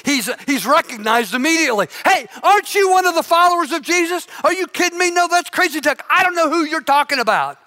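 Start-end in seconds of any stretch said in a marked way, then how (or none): 2.0-4.3 high, fast, pointed challenge; accusing question. Acted. Bystander accuses Peter. 4.4-7.6 high, rushed brush-off; nervous denial. Acted. Peter denies knowing Jesus.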